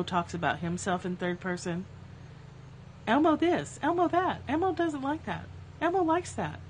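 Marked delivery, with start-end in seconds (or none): 3.1-6.6 annoying squeaky puppet voice, pitched high; Elmo. Acted. Imitating Elmo for Sesame Street watchers, annoyingly squeaky. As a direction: mimic a squeaky kids' puppet talking in third person. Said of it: "talk in an annoying squeaky voice".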